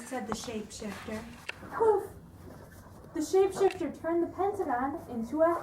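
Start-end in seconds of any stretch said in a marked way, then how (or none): 1.7-2.0 bright, louder magic poof sound effect. Acted. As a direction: pop it out like a spell going off.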